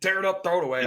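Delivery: playful exaggerated tantrum; mock dramatic quit. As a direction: fling it away in mock frustration. Mocking the quitter's reaction.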